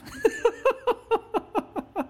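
a quick high chuckle; high laugh. Real, not acted. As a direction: laugh fondly at the memory. Laughing at own quote.

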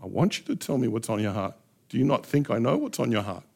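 a tender invitation from God; God speaking gently. Acted. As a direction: gently coax them to open up. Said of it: "God is actually telling us".

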